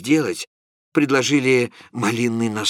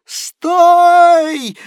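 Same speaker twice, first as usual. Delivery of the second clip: high, very loud cry; a sudden piercing cry of stop; Prokharchin's shout; 10 dB louder than usual. A narrator's reading. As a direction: shout to stop them cold. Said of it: "закричал господин Прохарчин".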